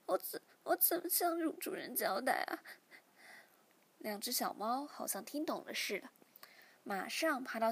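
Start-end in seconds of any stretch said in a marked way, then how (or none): 0.1-2.6 high, tearful stammer; crying. A narrator's reading. 小喵 confides in her kittens, crying and stammering. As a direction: choke on the words as tears come. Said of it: "心中再也忍不住哭了起来".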